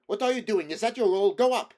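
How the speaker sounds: high, loud furious scolding; irritated poet yelling at his actor; angry shout. A narrator's reading. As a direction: order him up the stage.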